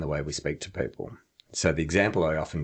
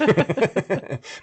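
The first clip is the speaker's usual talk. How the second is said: a bright burst of laughter; short loud laugh; high laugh; 13 semitones higher, 5 dB louder than usual. Real, not acted. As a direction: laugh at your own joke. Laughs at own example.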